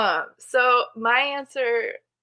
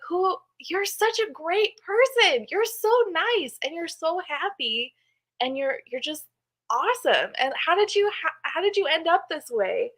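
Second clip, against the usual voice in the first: put-on high-pitched, adoring parent praising a child; gushing praise; 8 semitones higher than usual. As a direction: gush in wonder at your own kid. Mother retells gushing to her child.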